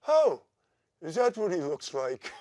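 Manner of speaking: a high, surprised mock [name]; lightly hammed-up [name] wonder; imitating Oz. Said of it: "he goes".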